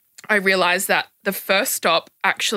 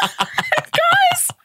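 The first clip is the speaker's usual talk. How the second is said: laughing disbelief; 10 semitones higher than usual. Real, not acted. Recalling the absurd party bus.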